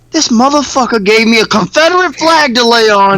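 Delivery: high, put-on indignant voice; imitating Nick outraged. Friend retelling the pillow discovery, high mock indignation. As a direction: play him fed up and indignant. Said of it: "He said".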